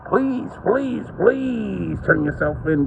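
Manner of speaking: high, slow mocking plea; begging in a mocking voice; mock pleading.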